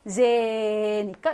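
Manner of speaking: 제 drawn out slow and long; teacher stretching the 제 vowel. Shown as a demonstration. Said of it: "한 번만 길게 말하기".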